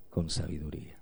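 whispered, soft; sermon point whispered. Real, not acted. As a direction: breathe the words for weight. Preacher drops voice for emphasis.

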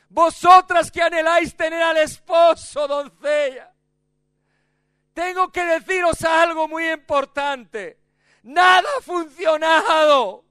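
grito agudo y fuerte de advertencia; la novia clama a las doncellas, más aguda; grito desesperado. Acted. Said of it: "Ella grita un juramento". (la novia advierte a las doncellas gritando)

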